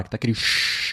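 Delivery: soft breathy shhh like a dead channel; quiet static hiss sound; imitated TV static. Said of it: "aquele chiado".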